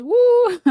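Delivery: high, loud whooping whistle sound; whistle imitation. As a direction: mimic a car's novelty whistle. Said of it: "the whistle goes".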